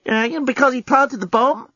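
high-pitched hesitant query, rising on PLANTED; timid question. A narrator's reading. Lucy asks why he killed him, childishly tentative. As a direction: ask it back like an unsure child.

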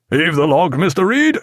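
crisp command to the officer; captain ordering the log hove; lightly voiced captain. A narrator's reading.

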